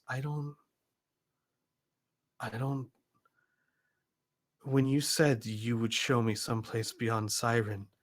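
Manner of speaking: soft, halting, bewildered delivery; long pauses, quietly struggling to take it in; stunned Levine. Acted. Levine learning she is on a spaceship, quietly stunned.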